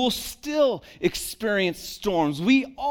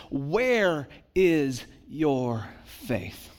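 slow reading; 5 semitones lower, much slower than usual. Shown as a demonstration. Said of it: "literally is".

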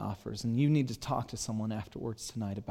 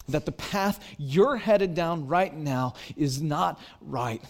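rising, louder, slower fervent preaching; passionate preaching; 5 semitones higher, 6 dB louder than usual. Acted. Pastor stirring up his congregation about the thrill of faith, fervent preaching. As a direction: build the crowd up with fervent joy.

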